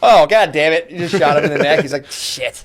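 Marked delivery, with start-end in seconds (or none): 2.1-2.6 whispered curse. Retold or imitated. Victim reaction game.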